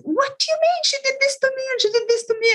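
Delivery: very high, fast mock outrage; hammed-up high-pitched complaint; protesting client. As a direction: imitate an upset client pleading her grievance. Client protests in retold quarrel.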